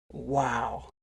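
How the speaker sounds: low, soft, impressed wow; retold moment of awe, hushed and low; awed. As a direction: breathe out quiet admiration. His own awed reaction retold.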